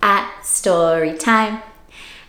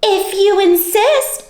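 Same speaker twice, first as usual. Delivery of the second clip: much higher, slow, sweet reply; sweetly; 10 semitones higher, 4 dB louder, slower than usual. Acted. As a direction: answer with calm syrupy sweetness. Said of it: "answered the friendly ladybug sweetly".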